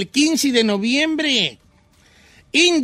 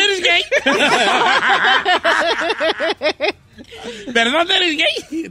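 asks through laughter, higher pitch; can't hold back the laugh while teasing; 6 semitones higher, slower than usual. Real, not acted. Host teasing his co-host about Pride, laughing.

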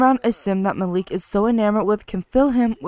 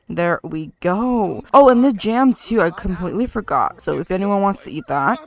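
usual speaking voice

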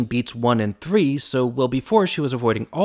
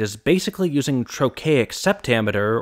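ordinary delivery

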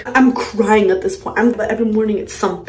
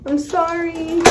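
put-on high, drawn-out small child voice; imitating a sweet child saying sorry; high-pitched, stretched-out childlike apology; apologetic little daughter; 7 semitones higher than usual.

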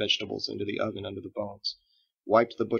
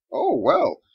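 eager; 8 dB louder than usual. Acted. Boy eager to share.

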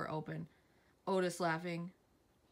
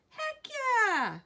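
excited; 14 semitones higher, 7 dB louder than usual. Acted. Excited reply to praise.

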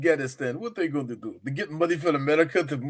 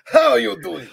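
mock leader greeting; 5 semitones higher, 5 dB louder, much slower than usual. Greeting general in skit.

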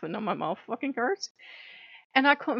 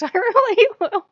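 high, loud, through laughter; real laugh lifting the voice high; laughing insistence; 13 semitones higher, 10 dB louder than usual. Real, not acted. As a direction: insist while cracking up. Laughing at own threat.

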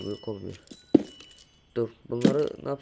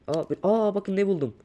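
higher, louder surprised gasp; delighted at the lucky find; 8 semitones higher, 6 dB louder than usual. Real, not acted. Finds ore, surprised.

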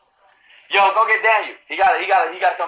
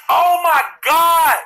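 higher, slow excited shout; 10 semitones higher, 3 dB louder, much slower than usual. Real, not acted. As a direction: yell it out hyped. Hype shout.

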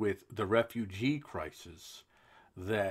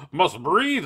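much higher, louder cartoon politician; silly Juncker voice; 8 semitones higher, 11 dB louder than usual. Host reads the EU president's quote in a silly voice. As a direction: lampoon him with a goofy pompous voice. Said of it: "make him sound silly".

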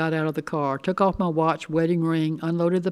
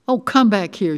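louder, higher plea with a slight break; upset, raised plea; 5 semitones higher, 7 dB louder than usual. A narrator's reading. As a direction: plead as the voice starts to give. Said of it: "her voice breaking".

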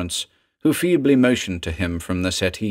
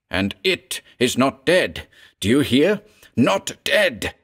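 emotional outburst; 5 semitones higher than usual. A narrator's reading. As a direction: pitch climbing higher into a frantic cry on not dead. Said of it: "a torrent of suppressed emotion broke forth".

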